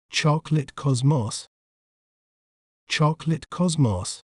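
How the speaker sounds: British pronunciation of "chocolate cosmos", short rounded o in "cosmos"; British accent. Shown as a demonstration. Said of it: "In British accent".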